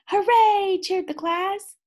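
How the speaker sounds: high, loud cheering hooray. A narrator's reading. Cats cheer that it's milk time, high and loud. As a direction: cheer like the whole class.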